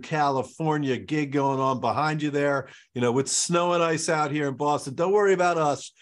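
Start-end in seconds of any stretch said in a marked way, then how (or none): none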